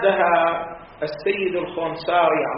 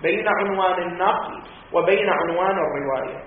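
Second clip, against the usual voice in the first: usual speaking voice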